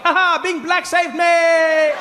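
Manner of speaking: high, drawn-out gloating cry; gleeful escape. Retold or imitated. Comic escaping from the back row, high gleeful cry. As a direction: crow it while running away.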